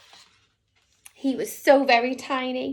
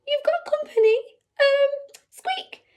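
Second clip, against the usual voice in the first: nervous squeaky mouse; 12 semitones higher, 4 dB louder than usual. Acted. Tiny mouse wakes the lion.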